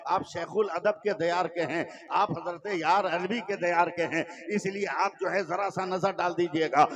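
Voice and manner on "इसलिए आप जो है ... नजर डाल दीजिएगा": ordinary delivery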